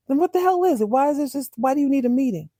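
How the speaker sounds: mock-impatient mother pressing her son; exasperated demand. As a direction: demand an explanation, voice jumping up. Acts out mother demanding an answer.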